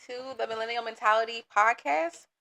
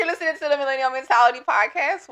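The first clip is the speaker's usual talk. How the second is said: energetic; 4 semitones higher, 7 dB louder than usual. Real, not acted. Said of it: "There we go".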